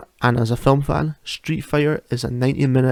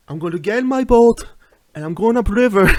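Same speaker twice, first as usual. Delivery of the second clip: much higher, louder hammed-up action hero; Jean-Claude Van Damme impression voice; 9 semitones higher, 5 dB louder than usual. Quoting a favourite movie speech, in a much higher, louder hammed-up hero voice. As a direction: declare it like a film action hero, pitched way up. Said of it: "Jean-Claude Van Damme, "I'm not going home" speech".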